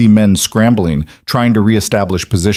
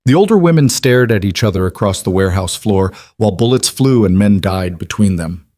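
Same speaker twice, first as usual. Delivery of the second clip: usual speaking voice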